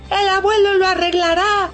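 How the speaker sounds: put-on high, urgent child; boy running to grandpa; niño corriendo. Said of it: "se fue corriendo". (niño agitado, voz aguda)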